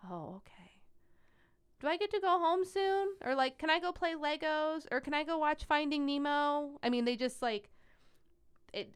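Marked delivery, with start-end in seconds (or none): 0.0-0.8 small, soft imitated kid reply; child accepting it. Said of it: "And they go". 1.8-3.2 put-on high, louder little-kid voice; young child asking. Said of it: "you tell a five-year-old that". 3.6-6.8 put-on high, pleading little-kid voice; young child asking. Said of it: "you tell a five-year-old that".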